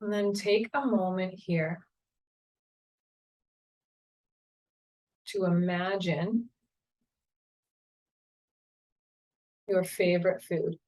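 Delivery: calm, unhurried meditation guide voice; guided meditation. Acted.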